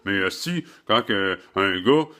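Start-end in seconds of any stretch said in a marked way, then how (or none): none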